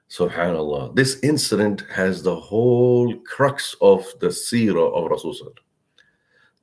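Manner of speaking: ordinary talk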